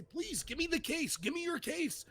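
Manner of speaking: pleading. Retold or imitated. Asking opponents for their argument, pleading. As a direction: press them earnestly, pitch lifted. Quoting own plea to opponents.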